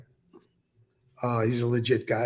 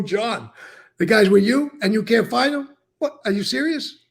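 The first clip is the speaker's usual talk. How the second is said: higher, louder incredulous boss; mock disbelief; 9 semitones higher, 6 dB louder than usual. John scolds Jack, higher incredulous mock outrage. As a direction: play John's stunned disbelief. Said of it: "John's again now disappointed".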